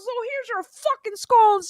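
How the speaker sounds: high-pitched, drawn-out imitated anger; angry. Imitating angry friend throwing scones, high slow outburst. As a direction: act out her exasperated outburst. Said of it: "when she was angry".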